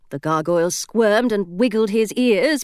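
usual speaking voice

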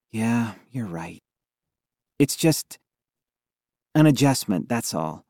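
softly sighed concession; resigned sigh. A narrator's reading. Allie conceding to her friend, resigned sigh. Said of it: "Allie sighed".

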